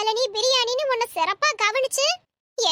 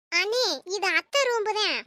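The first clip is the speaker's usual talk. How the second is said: ordinary talk, nothing marked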